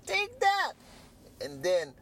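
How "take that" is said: high, slow, drawn-out taunt; acted frenzy. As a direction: act out someone hurling things, pitched way up. Acts out uncle ripping plants out.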